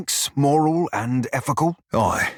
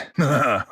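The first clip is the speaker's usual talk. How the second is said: short laugh; 2 semitones higher than usual. Acted. Laughs at own joke.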